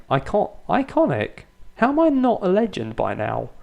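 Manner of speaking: high-pitched indignant disbelief; incredulous. Real, not acted. Manager reacting to being called iconic, incredulous.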